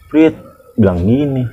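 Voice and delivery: ordinary talk, nothing marked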